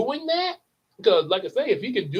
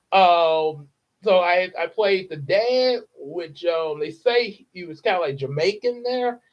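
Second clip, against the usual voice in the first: normal talking voice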